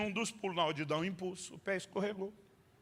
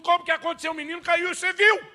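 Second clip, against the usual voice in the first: higher, louder exasperated yell; wanting to shout; 10 semitones higher, 10 dB louder than usual. Retold or imitated. Father exasperated with mom's question, yelling high. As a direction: scream it to the heavens. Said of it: "Eu quero gritar pra Deus".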